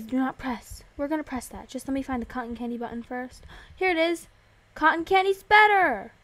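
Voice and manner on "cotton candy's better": higher, louder burst of delight; excited cheer. Real, not acted. Player cheering for favourite candy flavour, excited cheer. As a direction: champion your favourite flavour loudly.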